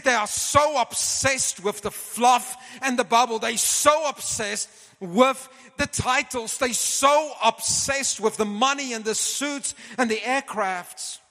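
high, loud imitation of a fervent preacher; preaching voice. Retelling Reinhard's preaching to him.

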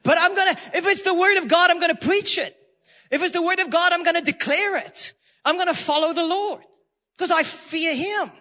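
ringing defiant vow; bold declaration. Real, not acted. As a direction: declare it with fire. Preacher declaring conviction.

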